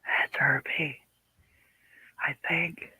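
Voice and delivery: soft unvoiced whisper; whispered aside. Real, not acted. Panel member quietly guessing who it is, whispered. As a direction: whisper it under your breath.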